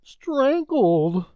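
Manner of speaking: high, breathless servant voice; frightened butler breaking the news; alarmed servant. Acted. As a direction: blurt out the bad news. Servant describes dead dog.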